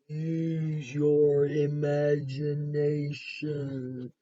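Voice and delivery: stretched slow and dreamy; slow sing-song read. A narrator's reading. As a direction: draw the words out slowly. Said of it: "drawn-out reading with music".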